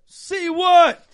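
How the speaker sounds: high loud snapped question; loud retort. Retold or imitated. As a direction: bark it back loud. Retold angry retort.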